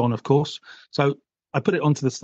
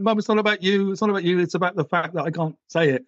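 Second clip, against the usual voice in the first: higher, insistent explanation; raised insistent reply to mum; pleading with mum; 5 semitones higher than usual. Retold or imitated. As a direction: press the point to mum, pitched up. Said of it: "And I'm like".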